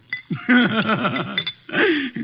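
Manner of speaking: a satisfied chuckle at Archie's expense; amused chuckle. Acted. Wolfe teasing Archie about trusting women, amused chuckle. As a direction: laugh fondly, pleased with the joke.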